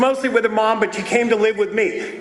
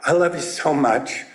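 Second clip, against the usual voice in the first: tender to daughter; 6 semitones lower than usual. Retold or imitated. Father to his scared daughter, low and tender. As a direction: soothe her gently. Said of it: "I said".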